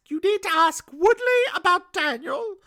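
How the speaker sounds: high-pitched put-on lady voice; Jessica. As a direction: play an insistent woman, pitched well up. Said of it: "and she's like".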